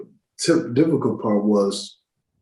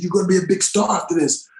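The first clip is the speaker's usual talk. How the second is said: high, fast imitated promise; quick retold hype; hyped-up director; 8 semitones higher, faster than usual. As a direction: rattle off a director's pep talk. Director hyping him up.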